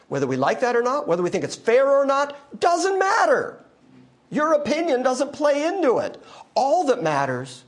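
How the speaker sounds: rising to a high, loud pulpit climax; full force preaching. Acted. Preacher to congregation, full force. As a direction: preach it hard, slowing on the peak.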